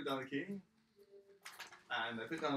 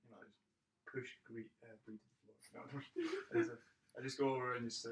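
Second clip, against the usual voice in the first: ordinary talk, nothing marked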